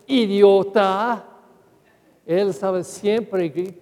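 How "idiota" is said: imitated scolding father. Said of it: "mi papá dijo a mí".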